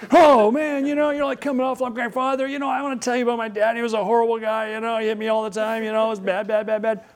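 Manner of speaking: high frantic Richard Pryor voice, spilling a sob story; a teacher mimics a comic deflecting his friend with his father's story; Richard Pryor impression. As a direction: rattle on high to dodge the question. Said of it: "Richard Pryor's just spinning out".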